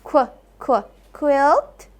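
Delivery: kw, kw, then quilt; phonics sounding out. Shown as a demonstration. Teacher checks quilt for the r sound, sounding out. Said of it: "does this have the r sound".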